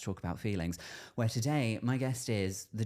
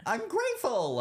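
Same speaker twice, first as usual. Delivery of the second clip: high, louder squeal of thanks; mock indignant gratitude; 13 semitones higher, 4 dB louder than usual. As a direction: protest it in a comically high voice. Mock protest at repeated thanks.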